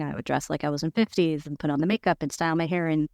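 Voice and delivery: ordinary delivery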